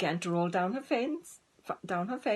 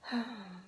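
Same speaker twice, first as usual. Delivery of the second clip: little sigh; 3 semitones higher, 5 dB quieter than usual. Acted. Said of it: "Dora gave a little sigh".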